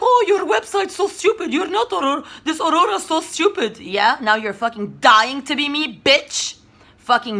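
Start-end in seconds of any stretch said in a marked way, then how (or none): 0.0-3.8 high, sneering put-on voice; scoffing at her website, mocking; mocking Tatiana. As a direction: mimic her disdain, pitched up. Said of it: "She's like".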